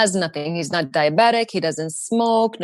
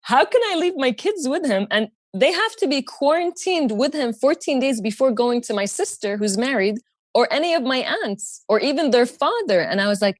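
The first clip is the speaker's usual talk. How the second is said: ordinary talk, nothing marked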